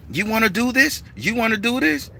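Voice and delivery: high, retold threatening challenge; fight challenge. The stranger squaring up to the Baron, threatening. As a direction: dare him to fight.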